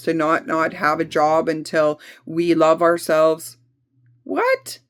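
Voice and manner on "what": very high squeaked what; sudden disbelief at the idea; mock-shocked high what; incredulous what. Real, not acted. Mocking absurd waiting.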